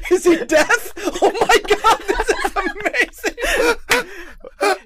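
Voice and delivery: high, laughing delight. Real, not acted. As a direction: speak through laughter.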